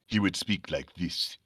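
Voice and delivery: low, soft African-accented character voice. Shown as a demonstration. Comic fan voicing tribesmen from Conan, African accent. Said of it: "I was doing all these accents".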